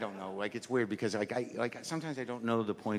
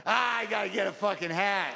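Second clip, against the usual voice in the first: put-on old guy's outburst; 8 semitones higher than usual. Old guy spots a hat across the street, exclaiming high. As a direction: blurt it out high, as a covetous old man.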